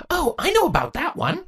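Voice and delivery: high, bright eager burst; excited exclaim. Acted. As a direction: blurt it out excitedly.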